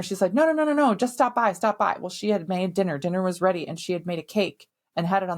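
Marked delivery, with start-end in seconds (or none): 0.3-2.0 insistent rapid-fire coaxing, higher and louder at first; high, urgent string of refusals easing into coaxing; pressuring. Retold or imitated. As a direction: imitate a pushy mother-in-law brushing off objections. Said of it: "she was pressuring him to stop by".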